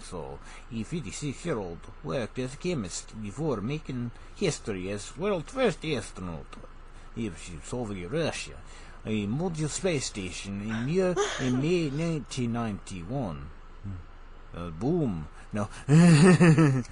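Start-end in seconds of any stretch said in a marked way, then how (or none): 0.0-6.4 put-on Russian accent with swooping tune; hammed-up Russian newsreading accent; mock Russian accent. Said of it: "I have to get the proper accent". 7.2-15.3 put-on Russian accent with a bobbing tune; playful Russian accent; mock Russian accent. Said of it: "say it in a Russian accent".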